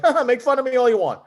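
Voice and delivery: high, loud mock laugh and taunt; playful gloating. Imagined bracelet winner to hecklers, playful gloating. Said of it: "jiggle it and go like".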